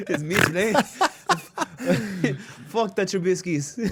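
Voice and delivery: high, laughing mock Irish accent; Irish accent through laughter. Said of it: "I'm, like, kinda Irish".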